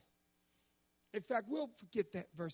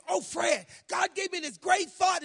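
high, loud preacher shout; mock preaching; 9 semitones higher, 10 dB louder than usual. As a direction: burst into excited preaching. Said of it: "I get the preaching".

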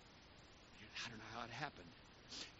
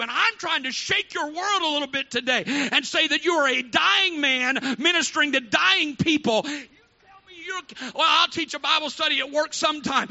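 loud, higher shouting sermon; preaching at full force; 10 semitones higher, 14 dB louder than usual. Acted. Preacher shouting urgency to the congregation, loud preaching. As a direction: shake the room with urgency.

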